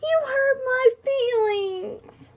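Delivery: high, slow, hammed-up wail. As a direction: wail it out like a crushed hippo. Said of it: "she would wail".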